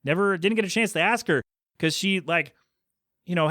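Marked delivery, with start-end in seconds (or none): none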